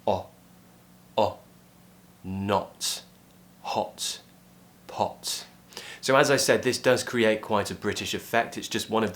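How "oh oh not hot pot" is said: quick rounded o in "Not, hot, pot"; each word alone, tongue slightly back on the short o. Shown as a demonstration. Teacher drills the British vowel, short round o in "Not, hot, pot". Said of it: "feel your tongue just slightly pull back".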